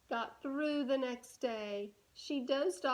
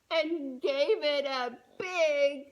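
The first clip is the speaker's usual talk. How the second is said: louder, slowed build to the punchline; 5 dB louder, slower than usual. A narrator's reading. Reader building the big sleepy moment. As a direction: stretch it out and swell.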